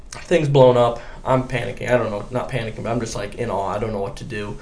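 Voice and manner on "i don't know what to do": ordinary talk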